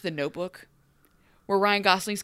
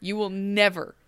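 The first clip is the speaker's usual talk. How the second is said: usual speaking voice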